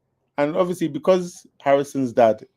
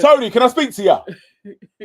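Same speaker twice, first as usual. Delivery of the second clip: much higher, loud laughing yell; mock shout; 10 semitones higher, 8 dB louder than usual. Friend mocking Harry shouting for Tony, loud laughing yell. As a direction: bellow it across the room. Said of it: "go in there and shout".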